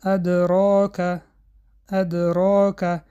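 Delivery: ادراک slow, with a small bounce on the د; light qalqala. Shown as a demonstration. Teacher recites ادراک for students with minor qalqala. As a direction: give the د in ادراک only a small echo. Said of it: "در بین کلمه".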